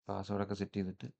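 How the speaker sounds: usual speaking voice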